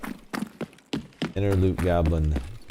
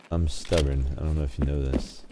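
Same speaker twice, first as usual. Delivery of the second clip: normal talking voice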